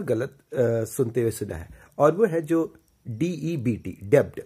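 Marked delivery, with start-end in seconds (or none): 4.0-4.4 डेब्ट with the b sounded, "debt" as "debbt"; common mispronunciation. Shown as a demonstration. Teacher quoting how people misread DEBT, common mispronunciation. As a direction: say डेब्ट as "dept". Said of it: "उसको लोग डेब्ट बोलते हैं".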